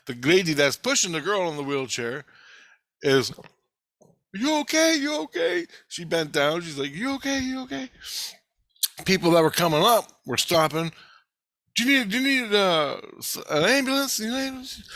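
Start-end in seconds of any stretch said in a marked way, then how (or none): 4.3-5.7 very high-pitched voice of a concerned woman; mimicked concerned woman. Retold concerned woman checking on him. 6.9-7.9 high, slightly softer imitation of a worried woman; mimicked concerned woman. Retold woman bends down to check. 11.8-14.8 high-pitched imitation of worried helpers; mimicked concerned bystanders. Retold passers-by offering help.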